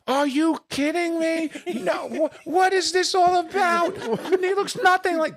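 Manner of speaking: very high, slow, stretched mock outrage; infuriated outburst. Comic falling for the inside joke, hammed-up fury. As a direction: blow up in exaggerated exasperation. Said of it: "I'd just get infuriated".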